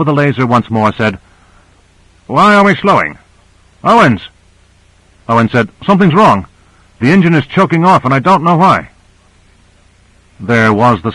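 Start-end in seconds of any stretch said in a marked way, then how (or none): 2.3-4.2 higher-pitched, urgent question; demanding call. A narrator's reading. Surgeon calls from the workroom to the pilot, demanding. 5.9-8.9 higher-pitched, urgent pilot's voice; alarmed report. A narrator's reading. Pilot tells crew the engine is choking off, alarmed.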